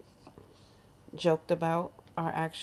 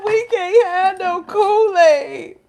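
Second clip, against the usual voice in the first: loud, high, drawn-out mock wail; mock crying; 12 semitones higher, 12 dB louder, much slower than usual. Cousin crying over Kool-Aid, hammed-up wailing. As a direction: drag it out like a bawling complaint. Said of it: "They were crying about Kool-Aid".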